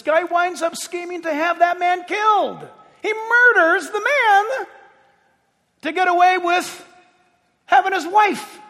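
high, outraged pulpit cry; full-force preaching. Acted.

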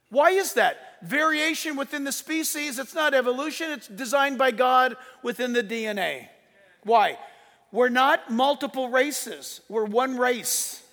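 ordinary talk

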